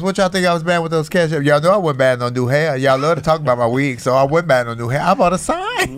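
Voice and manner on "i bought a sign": laughing. Real, not acted. Punchline about cash apps.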